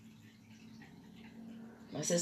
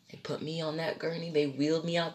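normal talking voice

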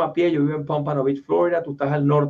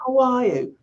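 higher-pitched mock British "how are you"; British accent; 7 semitones higher than usual. Shown as a demonstration. Newcomer whom Americans don't understand, mock British accent. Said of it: "hablas con un acento british".

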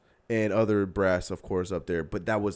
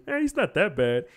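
mocking dismissive voice; 7 semitones higher than usual. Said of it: "to have somebody tell me".

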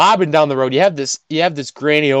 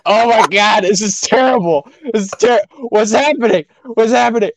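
high, louder acted dismay; mock panic; 8 semitones higher, 3 dB louder than usual. Man mortified in a bathroom stall, mock panic. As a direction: play up the horrified embarrassment.

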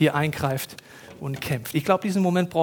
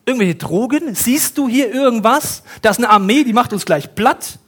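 higher and louder, mocking disbelief; incredulous outburst; 5 semitones higher, 5 dB louder than usual. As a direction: scoff at the master as if he's crazy. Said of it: "Der Diener denkt sich". (ungläubig, höher und lauter, spöttisch)

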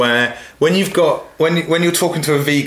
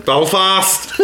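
"Belfast" in a strong Northern Irish accent, a touch higher; 5 semitones higher than usual. Shown as a demonstration. Co-host asks him to repeat Belfast, Northern Irish accent. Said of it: "say Belfast again".

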